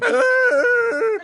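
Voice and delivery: high, drawn-out squealed laugh; mock high squeal. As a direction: squeal up high to tease him. Teasing Jack with high voice.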